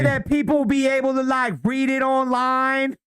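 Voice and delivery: imitating her asking the AI, hammed-up; mock voicing her. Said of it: "what she was like".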